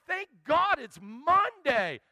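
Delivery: joyful preacher praising every weekday, high and loud; joyful praise. Retold or imitated. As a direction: shout for joy over each new day. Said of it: "I'm like".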